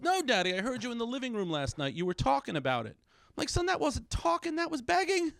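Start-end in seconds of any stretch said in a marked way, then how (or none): 0.0-3.0 lightly voiced kid, higher pitch; son protesting. Son explains to dad what he overheard, lightly voiced kid. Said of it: "He goes". 3.5-5.3 high, slow, emphatic mock protest; exasperated dad. Dad corrects son about what he overheard, acted exasperation.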